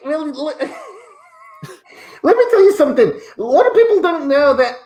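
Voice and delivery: giggling while trying to stay in character; laughing. Acted. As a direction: laugh your way through the catchphrase. Cracks up on the catchphrase.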